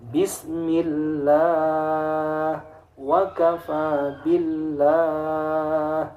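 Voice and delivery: BISMILLAH, WA KAFA BILLAH chanted slowly with long open 'laa'; thin tarqiq. Shown as a demonstration. Teacher recites tarqiq examples, slow thin vowels. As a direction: draw out the light 'a' ending of BILLAH. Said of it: "contoh dibaca tarqiq".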